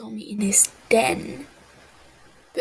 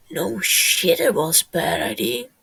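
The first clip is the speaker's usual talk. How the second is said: hiss; 3 semitones lower, 9 dB louder than usual. Acted. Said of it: "Techno hisses".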